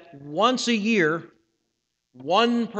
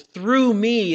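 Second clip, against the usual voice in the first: "through me" pushed higher and louder; emphatic; 4 semitones higher, 7 dB louder than usual. Shown as a demonstration. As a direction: punch "through me" hard. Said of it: "is in an emphatic position".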